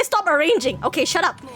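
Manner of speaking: normal talking voice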